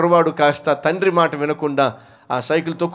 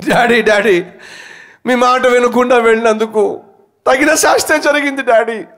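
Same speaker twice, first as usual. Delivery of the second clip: put-on higher and louder, a tearful boy's plea; crying plea; 10 semitones higher, 8 dB louder than usual. Boy begs his father's forgiveness, tearful and loud. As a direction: cry out the apology to daddy. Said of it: "అని ఏడుస్తూ ఉన్నాడు".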